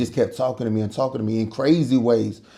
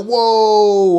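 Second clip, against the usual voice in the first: shocked whoa; 9 semitones higher, 5 dB louder than usual. Retold or imitated. Shocked by friend's words.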